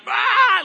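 high loud mock scream; demon shriek. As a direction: let out a dramatic demon cry. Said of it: "and the demon, like".

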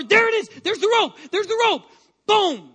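excited breakthrough. Acted. Preacher voicing the woman reaching the cloak, excited cry. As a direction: shout it as she finally reaches.

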